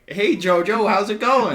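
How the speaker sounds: high, loud chummy Hitler. Retold or imitated. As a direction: play a buddy-buddy Hitler, bright and loud. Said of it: "He's like".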